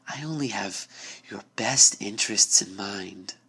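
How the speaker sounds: the door mocking her fear, softly amused; amused sinister voice. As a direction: purr like a smiling predator. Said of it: "The voice seemed almost amused".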